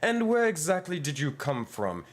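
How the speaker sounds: high, alarmed and suspicious; startled cleric. Acted. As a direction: demand who they are, rattled. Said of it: "he would respond".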